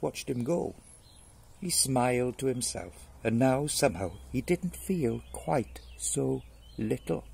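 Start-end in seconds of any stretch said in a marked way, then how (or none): none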